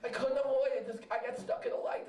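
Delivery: ordinary talk, nothing marked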